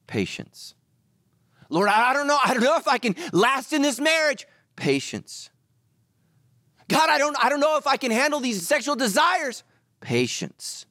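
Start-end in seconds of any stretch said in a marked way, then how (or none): 1.7-4.5 high, loud mock-desperate cry to God; desperate prayer. Acted desperate prayer about marriage. 6.9-9.6 high, loud mock-desperate cry to God; desperate prayer. Acted desperate prayer about desires.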